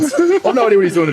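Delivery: high-pitched, baffled retelling; stunned disbelief. Retold or imitated. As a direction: act out being totally thrown. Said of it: "I was stunned".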